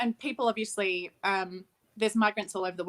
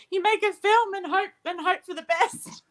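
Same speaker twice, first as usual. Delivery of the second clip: laughing; 12 semitones higher, 4 dB louder than usual. Real, not acted. Laughing off a heavy question.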